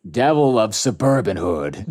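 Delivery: low, ominous villain voice; menacing texter. Acted. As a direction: drop low for a devilish screen name. Reads villain's username and text.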